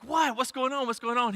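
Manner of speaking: high, startled retold reaction; alarmed dad. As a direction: ask it in high-pitched alarm.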